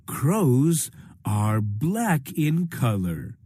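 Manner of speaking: usual speaking voice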